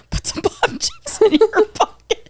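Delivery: high-pitched, laughing mock demand; insistent pestering. Law student pressing snacks on a friend, insistent. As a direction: push it on a friend, voice way up.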